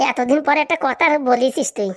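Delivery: ordinary talk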